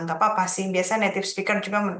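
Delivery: usual speaking voice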